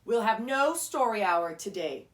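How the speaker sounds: loud, cold teacher's command; stern Miss Swamp. A narrator's reading. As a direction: crush their hopes firmly. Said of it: "said Miss Swamp".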